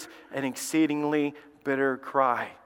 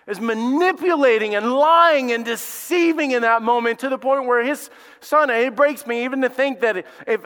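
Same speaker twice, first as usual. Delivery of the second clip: louder, higher full-force sermon; impassioned preaching; 5 semitones higher, 3 dB louder than usual. Acted. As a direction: preach it with rising fervor. Preaching builds up.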